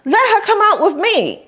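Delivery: high lady voice; sweet-talking society lady; imitating Madame. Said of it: "she said".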